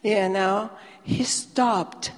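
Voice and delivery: ordinary delivery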